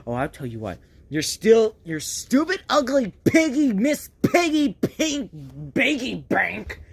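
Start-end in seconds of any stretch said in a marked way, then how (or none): none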